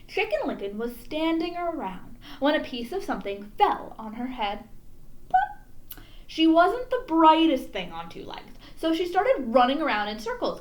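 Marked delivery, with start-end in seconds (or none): 5.3-6.0 high, popping plop; cartoon plop noise; plop sound. A narrator's reading. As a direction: pop it like something landing. Sound effect in story.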